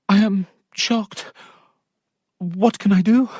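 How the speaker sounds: lightly voiced alarm; dismayed young lady. Sophia telling her maid she is shocked, high voice.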